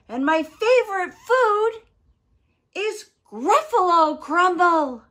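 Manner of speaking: high, very loud, gleeful declaration; threatening mouse. Acted. Mouse threatens to eat the Gruffalo, loud and high. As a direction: proclaim it loudly, landing on GRUFFALO CRUMBLE.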